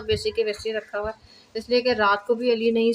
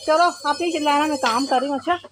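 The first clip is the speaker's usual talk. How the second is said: raised, higher-pitched telling-off; scolding; 6 semitones higher, 5 dB louder, faster than usual. Real, not acted. Telling the screamer to stop while working, loud high scolding. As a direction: call out a real telling-off.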